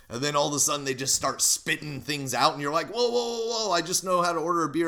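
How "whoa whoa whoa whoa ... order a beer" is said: high, fast, startled protest; startled whoa. Retold or imitated. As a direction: back off, overwhelmed and confused. Overwhelmed tourist in Mexico, retold.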